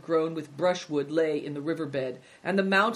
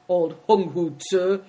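slow, louder grumble; growling; 6 dB louder than usual. A narrator's reading. Greencoat grumbling at the innkeeper, slow growl. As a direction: growl the name slowly and surly. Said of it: "growled Greencoat".

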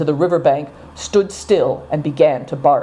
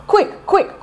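much higher, urgent hurry-up cry. A narrator's reading. Good Fortune urges Naidong to hurry, high urgent cry. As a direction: push them to hurry. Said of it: "cried Good Fortune".

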